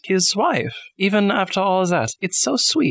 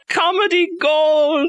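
high, drawn-out exclamation; gleeful crowing; 11 semitones higher, much slower than usual. Real, not acted. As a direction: crow it slowly, relishing the joke. Gleefully heckling the serious retelling.

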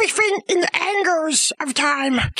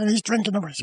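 very low, heavily pitched-down voice; deep cohost voice; much faster than usual. Acted. Cohost interrupts the storyteller, very low put-on voice. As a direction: sound like a giant grumbling.

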